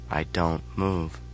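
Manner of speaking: ordinary delivery, nothing marked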